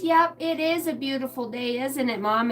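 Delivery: put-on high, chirpy little-kid agreement; toddler daughter. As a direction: agree like a pleased two-year-old. Acts out her daughter.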